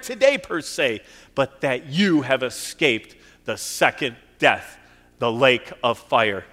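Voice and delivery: full-force preaching. Acted. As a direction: preach it out with full conviction to the church.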